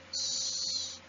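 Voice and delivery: "shh" hissed softly with rounded lips; sh sound. Shown as a demonstration. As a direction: push a voiceless "shh" through the teeth. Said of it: "has the sh sound".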